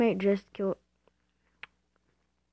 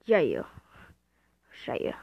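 ordinary talk, nothing marked

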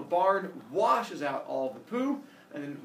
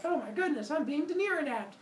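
panicked damsel; 12 semitones higher than usual. Acted. Daenerys voiced freaking out.

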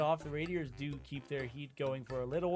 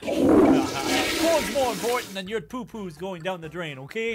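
mock scolding; 7 dB louder, slower than usual. Mock lecture about corn.

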